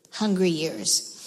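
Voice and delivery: normal talking voice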